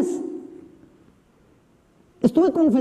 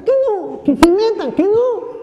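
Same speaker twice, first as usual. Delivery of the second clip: stubborn bickering; 10 semitones higher, 4 dB louder, slower than usual. Retold or imitated. Priest and women arguing back and forth.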